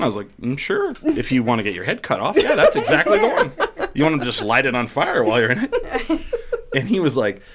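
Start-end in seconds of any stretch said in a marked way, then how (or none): 0.4-6.2 amused sarcasm breaking into laughter; sarcastic. Retold or imitated. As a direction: pretend to approve of a terrible idea. Sarcastic answer about a dangerous Walmart.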